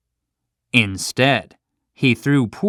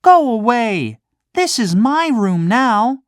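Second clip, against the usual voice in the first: rude; 11 semitones higher than usual. Acted. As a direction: snap at the cricket like a spoiled child. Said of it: "said Pinocchio rudely".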